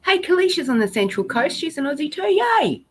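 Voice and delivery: high, delighted cheer; thrilled, rising to a high yay; excited welcome. Real, not acted. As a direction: cheer a fellow Aussie's arrival. Greeting a fellow Aussie viewer.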